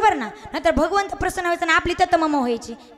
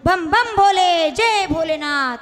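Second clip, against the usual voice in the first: slow, drawn-out loud cry of praise; loud devotional shouting; 5 dB louder, much slower than usual. Acted. Devotee cries to Shiva in the temple, loud shouting. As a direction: call out to the god, stretching each word. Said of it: "खूप मोठ्या मोठ्याने ओरडू लागलं".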